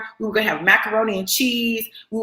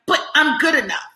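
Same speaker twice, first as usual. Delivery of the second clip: defiant self-talk; 6 semitones higher than usual. Retold or imitated. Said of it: "you're busy trying to convince the world".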